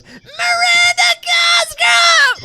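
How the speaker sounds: very high, drawn-out squeaky yell; squeaky shout. Retold or imitated. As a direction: shriek the name in a sped-up chipmunk voice. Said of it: "voice goes".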